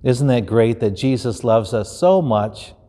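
ordinary delivery

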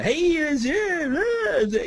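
high-pitched, drawn-out mock announcer voice; over-the-top hammed-up radio voice. Shown as a demonstration. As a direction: stretch it out way up high like a cheesy radio host. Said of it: "Don't use your radio voice".

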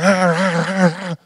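loud mock wolf snarling; wolf impersonation. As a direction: growl like a pack of wolves, badly faked. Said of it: "That was my impersonation of wolves".

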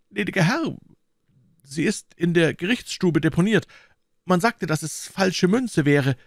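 high, anxious landlord voice; timid innkeeper. A narrator's reading. Innkeeper explaining the confiscated money to Athos, timid and high.

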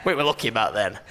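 put-on low, gruff old man. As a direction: grumble like a pensioner. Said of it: "sound older in our voices".

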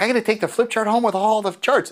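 high, bright-eyed grab at a prize; eager attendee. Retold or imitated. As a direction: play the thrilled attendee who won. Audience member grabbing free flip chart.

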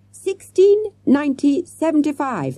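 high, urgent yell, controlled. A narrator's reading. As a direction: call out the number in excitement. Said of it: "Matthew yelled".

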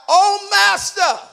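loud, high, drawn-out cry. Acted. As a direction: call out slowly to the master. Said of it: "cried out".

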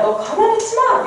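high urgent scold; dog scolding. Retold or imitated. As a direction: snap him out of it. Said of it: "이러는 거야".

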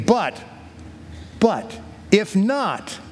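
slow, weighted "but, if not"; dramatic pause. Acted. Three men answer the king's threat, slow dramatic turn. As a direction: hang on "but", then stretch "if not".

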